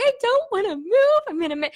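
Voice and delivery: high-pitched imitation of crying protest; mock tearful whine. Wife bawling on moving morning, mock tearful whine. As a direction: whine it like you're mid-bawl. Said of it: "I'm bawling my eyes out".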